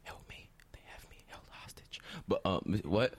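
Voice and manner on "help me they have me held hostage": desperate whispered plea; whispering. Acted. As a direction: breathe it as a secret cry for help. Hostage whispering for help.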